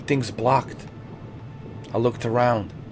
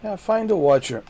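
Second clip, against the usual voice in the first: higher, sing-song mock of the quest prompt; mocking high echo; 8 semitones higher than usual. Gamer mocking the game's quest prompt, sarcastic high echo. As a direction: parrot the game's order back sarcastically.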